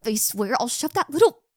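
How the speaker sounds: loud, rising tearful threat; angry threat. Acted. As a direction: blurt a furious threat through tears. Threatening classmate while upset.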